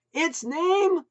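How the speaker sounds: high, loud; shrill it back. A narrator's reading. Wife shrilling back over the noise, high loud. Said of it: "Maureen shrilled back".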